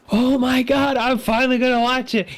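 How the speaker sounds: high, eager excited squeal. Retold or imitated. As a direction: gush like you can't wait. Retold eager self before watching.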